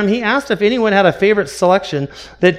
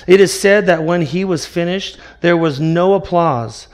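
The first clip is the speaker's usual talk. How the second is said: ordinary talk, nothing marked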